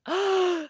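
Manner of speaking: sharp high gasp of shock; high retold gasp at the ending. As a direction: gasp as if hit by a twist. Retelling the book's ending.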